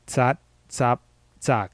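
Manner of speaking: "Tsat, tsap, tsak" one by one, final stops unreleased. Shown as a demonstration. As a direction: set apart "Tsat", "tsap", "tsak" for listening practice. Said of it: "tell the difference between".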